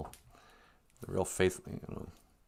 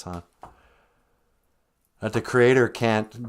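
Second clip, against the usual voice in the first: ordinary talk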